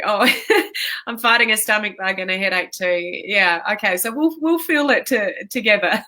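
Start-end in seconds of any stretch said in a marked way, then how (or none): 0.3-1.0 breathy laugh. Real, not acted. Laughs at viewer comment. 4.0-6.1 laughing. Real, not acted. Laughing with viewer.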